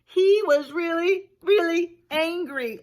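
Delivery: big dramatic read-aloud emphasis; emphatic storytelling. A narrator's reading. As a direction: stretch out really, really angry for effect. Reading McGreely's anger.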